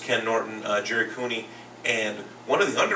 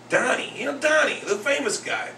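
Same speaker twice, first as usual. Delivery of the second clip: put-on very high, insistent kid voice; inner-city little boy; 13 semitones higher than usual. Second grader insists teacher knows the boxer, imitated high kid voice. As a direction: imitate a kid pressing his point, pitched way up. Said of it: "an inner-city little boy".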